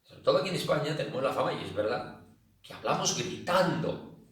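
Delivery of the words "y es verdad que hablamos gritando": ordinary talk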